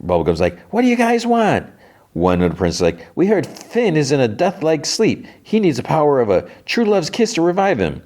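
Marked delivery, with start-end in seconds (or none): 0.7-1.6 high, sustained character voice; mock royal callout; acted princess demand. Princess answering suitors, acted. 3.1-8.0 high-pitched princess plea; hint of a storybook princess; lightly acted princess. Princess pleading, voiced in story.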